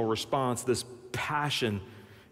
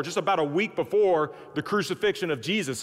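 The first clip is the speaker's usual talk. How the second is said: ordinary talk, nothing marked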